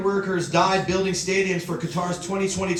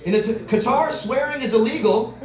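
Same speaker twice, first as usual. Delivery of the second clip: normal talking voice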